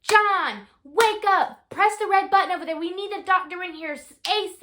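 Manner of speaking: retold nurse screaming orders at a sleepy husband. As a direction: bark urgent orders across the room. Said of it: "she screams at John now".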